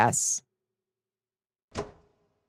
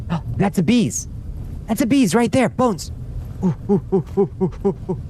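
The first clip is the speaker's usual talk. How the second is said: high, gasping thrilled squeals; excited; 10 semitones higher than usual. Real, not acted. Finding bees in the game, excited squealing.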